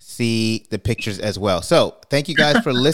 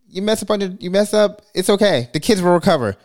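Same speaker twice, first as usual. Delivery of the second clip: cheeky confession; 7 semitones higher than usual. Real, not acted. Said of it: "I'm gonna say something really messed up".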